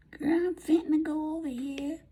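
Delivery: very high-pitched country grandma; Mississippi grandmother. Retold or imitated. Vlogger imitating her grandmother talking to a girl, high country voice. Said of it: "she would always say".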